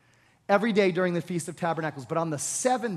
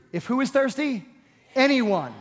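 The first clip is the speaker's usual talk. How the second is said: loud preaching; 6 semitones higher, 4 dB louder than usual. Acted. Preacher repeats Jesus' loud call.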